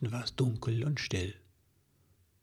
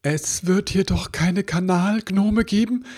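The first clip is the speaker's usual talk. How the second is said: high, loud and alarmed; a frightened little creature's panicked thought; horrified thought; 8 semitones higher, 5 dB louder than usual. A narrator's reading. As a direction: gasp it out in sudden dread. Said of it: "dachte er entsetzt".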